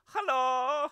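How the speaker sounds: light-voiced German. All for One greeting as a light-voiced German. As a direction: pipe it up in a German accent. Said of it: "light-voiced German".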